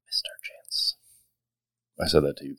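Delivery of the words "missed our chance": whispered regret; whispering. Acted. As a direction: breathe it out unvoiced. Hiding near the creature's nest.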